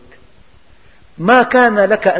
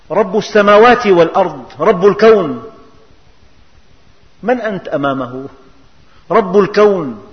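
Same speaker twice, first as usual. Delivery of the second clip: bold reply; 2 semitones higher, 5 dB louder than usual. Acted. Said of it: "قال له".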